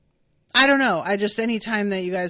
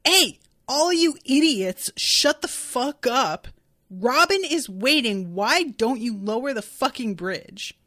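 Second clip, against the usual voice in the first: Luffy losing it; 2 semitones higher than usual. Retold or imitated. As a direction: act out an exasperated telling-off. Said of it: "Luffy kinda loses it".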